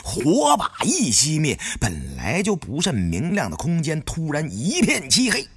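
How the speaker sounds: plain reading voice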